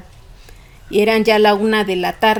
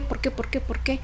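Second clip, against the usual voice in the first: quiet echo; 3 semitones higher, 8 dB quieter than usual. Real, not acted. Softly echoing her own question.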